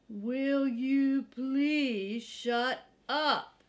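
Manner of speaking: slow, loud, annoyed growl of a dog woken up. Acted. Hank snaps at noisy Drover, slow and loud. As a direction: drag it out, fed up.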